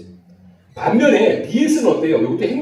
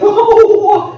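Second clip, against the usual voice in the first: shrill mock giggle; 10 dB louder than usual. Mimicking the spouse's giggle.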